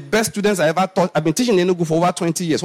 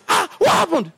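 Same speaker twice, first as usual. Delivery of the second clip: shocked; 13 semitones higher than usual. Retold or imitated. Retold shocked question.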